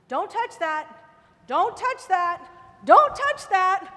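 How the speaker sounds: from firm to a shouted slow don't touch that; a parent's warning rising to a yell; building parent warning. Pretend. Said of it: "It's like he's saying".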